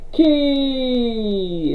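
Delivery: high, long-held call of the name; drawn-out call. Real, not acted. Host announcing the stock a viewer asked about, drawn-out call. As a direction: stretch it out high, gliding down.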